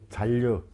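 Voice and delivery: 잔류 said as "잘류"; model pronunciation. Shown as a demonstration. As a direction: say 잔류 with a held double L. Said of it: "이렇게 읽으란 말이야".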